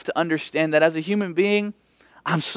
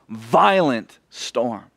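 ordinary talk, nothing marked

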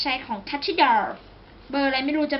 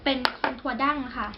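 ordinary talk, nothing marked

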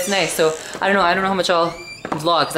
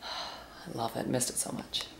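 whispered sigh; 4 semitones lower, 14 dB quieter, slower than usual. Real, not acted. Sighs over coffee.

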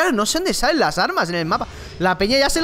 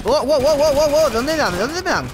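alarmed; 5 semitones higher than usual. Real, not acted. Under sudden fire.

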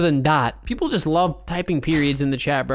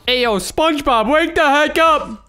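loud, high, drawn-out holler; calling out; 7 semitones higher, 4 dB louder, slower than usual. Pretend. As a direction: yell to rouse a sleeper. Calls out to wake a player.

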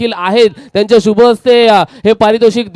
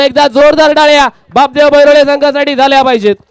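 raised, ringing call for applause; rallying announcer; 4 semitones higher, 3 dB louder than usual. Acted. Host calling on crowd to applaud the team, rousing announcer voice. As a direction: whip the crowd into clapping.

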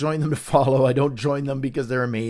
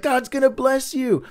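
mock preachy post; 9 semitones higher, 3 dB louder than usual. Mocking spam post.

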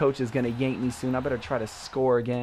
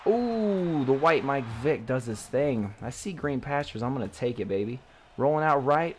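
ordinary talk

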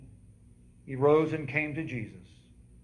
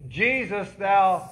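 crying out; 5 semitones higher, 8 dB louder, much slower than usual. Retold or imitated. Beggar's cry retold.

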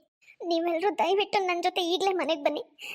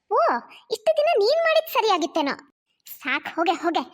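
sarcastic dismissal; 2 semitones higher, 4 dB louder than usual. Acted. Mother dismisses daughter sarcastically.

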